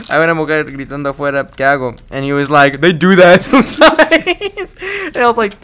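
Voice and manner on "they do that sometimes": slow, high, laughing delivery; laughing quote. Retold or imitated. Quoting dad's reply on the phone, laughing. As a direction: stretch it out into a laugh.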